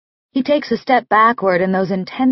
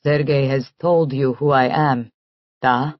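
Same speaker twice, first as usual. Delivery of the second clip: put-on lower, softer Russian-accented, icy and even; cold, even, calm; 7 semitones lower, 3 dB quieter than usual. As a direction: state it coldly, hurt held down. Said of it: "his voice is so cold, so even, so calm".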